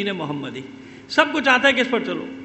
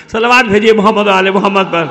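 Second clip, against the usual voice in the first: loud, lower-pitched rallying call; calling for salawat; 5 semitones lower, 9 dB louder than usual. Real, not acted. Preacher urging the congregation to send blessings, loud call. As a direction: call out loudly to the whole congregation.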